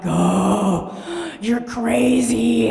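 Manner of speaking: loud, breathy rush with stretched-out words; giddy exhilaration. Acted. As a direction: blurt it out loud and long, overcome by spring. Acts out springtime exhilaration.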